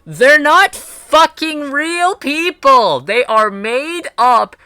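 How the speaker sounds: high, slow, emphatic half-shout; exasperated rant. Real, not acted. Streamer answering offended people about fictional characters, exasperated rant. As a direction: hammer each word out, drawn out and incredulous. Ranting back at the upset people.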